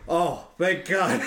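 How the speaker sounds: relieved sigh. Retold or imitated. Relieved guy in bathroom, retold.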